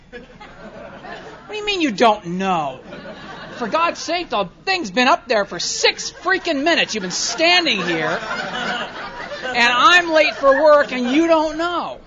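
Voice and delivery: loud, high, slow incredulous rant; exasperated rant. Retold or imitated. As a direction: drag the words out in disbelief. Inner rant at slow customer.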